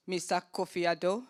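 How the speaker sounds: ordinary talk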